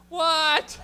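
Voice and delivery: high, loud triumphant "what"; cocky shout. Acted. Comic crows after his own punchline, cocky shout. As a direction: yell it as a boast.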